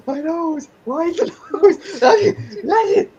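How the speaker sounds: high, loud giggly words; laughing. Real, not acted. Player cracking up at the werewolf nose joke, laughing. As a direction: talk through a fit of giggles.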